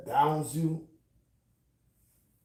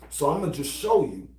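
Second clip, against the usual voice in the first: ordinary talk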